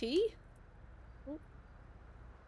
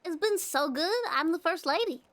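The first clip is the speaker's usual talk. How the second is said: higher-pitched, gushing first lady; excited first lady; 6 semitones higher, 4 dB louder than usual. Acted. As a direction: gush to an acquaintance as first lady. In-character greeting to acquaintance.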